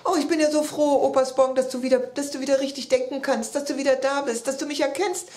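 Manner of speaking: high, breathless-sounding gush with restarts; tumbling-over-words joy. A narrator's reading. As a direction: babble happily, words racing ahead. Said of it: "konnte gar nicht so schnell sprechen". (überglücklich drauflosplappern, sich fast überschlagend)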